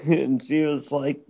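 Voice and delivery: ordinary delivery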